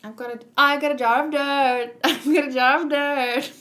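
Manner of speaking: hammed-up singsong movie-line imitation; playful mock gloating singsong; taunting singsong quote. Quoting a Jack Sparrow movie line.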